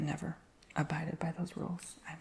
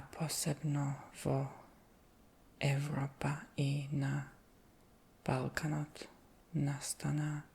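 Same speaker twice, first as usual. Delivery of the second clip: slow careful reading; much slower than usual. Real, not acted. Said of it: "I have to read it very slow".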